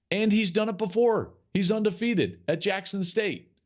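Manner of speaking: high-pitched, emphatic enthusiasm; very excited. Real, not acted. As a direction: sell it with real excitement. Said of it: "I'm very excited about this".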